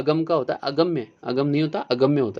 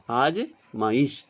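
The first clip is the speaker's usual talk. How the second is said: आजमाइश drawn out slowly, every syllable clear; slow careful demo; much slower than usual. Shown as a demonstration. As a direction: say आजमाइश very slowly, long आ at the start. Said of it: "अजमाइश नहीं आजमाइश". (आजमाइश को बहुत धीरे, साफ़-साफ़ बोलें)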